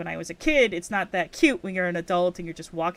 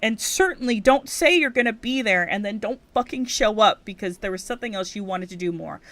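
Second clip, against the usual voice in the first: ordinary delivery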